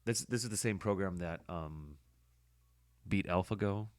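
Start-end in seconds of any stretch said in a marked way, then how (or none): none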